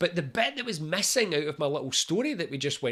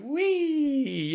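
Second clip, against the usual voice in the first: high, giddy squeal of joy; giddy wee; 11 semitones higher than usual. Pretend. Patient floating on strong painkillers, squealing with giddy joy. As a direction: let it fly up like you're floating on painkillers. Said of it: "You're like".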